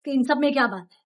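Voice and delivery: ordinary talk, nothing marked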